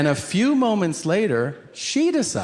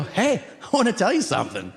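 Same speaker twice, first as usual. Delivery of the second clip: hurried aside; 3 semitones higher, faster than usual. Retold or imitated. Rushed private whisper to wife.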